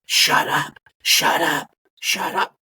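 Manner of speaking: urgent whispered shut up; hushed shut up. Retold or imitated. Lady hushing caller on plane.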